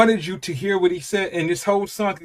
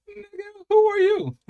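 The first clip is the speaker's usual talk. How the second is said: high-pitched shocked question; stunned disbelief; 4 dB louder than usual. Retold or imitated. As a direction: act floored by your son. Dad shocked at son.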